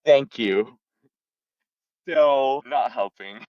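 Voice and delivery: dry, sarcastic and slowed down; deadpan sarcasm, slowed down; sarcastic thanks. Real, not acted. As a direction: thank them with mock gratitude. Sarcastic reply to insult.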